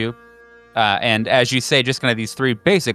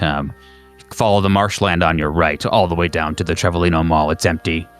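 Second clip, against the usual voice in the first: low-pitched handler giving instructions; brief the agent in a lowered, procedural voice; agency phone operator; 5 semitones lower than usual. Acted. Operator gives caller instructions.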